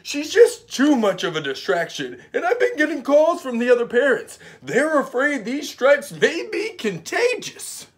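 high, loud put-on principal voice; principal's voice. Principal phoning the mother, high and loud.

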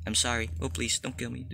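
soft, small mock begging; quiet pleading. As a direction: plead for your life under your breath. Begging Granny not to kill him.

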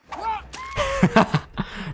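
long high laugh falling away; high cackle. Real, not acted. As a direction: cackle up high then let it tumble down. Laughing at survivor escaping.

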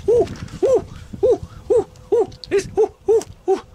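high, excited little string of oohs; overexcited. Acted. Veronica swooning over the funky farmer's picture, overexcited. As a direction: coo in short, high bursts of excitement. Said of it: "I'm overcoming excitement now".

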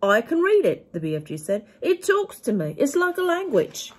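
big, high, loud giant voice, kindly and earnest; a friendly giant explaining wonders, up high and loud; the BFG. A narrator's reading. BFG voice reading story.

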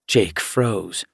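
usual speaking voice

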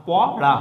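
teacher's split reading of প, রা; syllables drawn separately. Shown as a demonstration. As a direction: say প, then a separate loud রা. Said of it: "এটাকে আলাদা করে টানতে হবে".

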